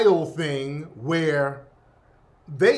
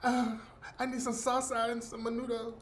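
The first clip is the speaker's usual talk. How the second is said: hammed-up Puerto Rican accent, much softer, half-whined; mocking a fake Puerto Rican accent, quiet and whiny; exaggerated Puerto Rican accent to ridicule an immigrant; 3 semitones higher, 10 dB quieter than usual. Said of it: "With all that accent".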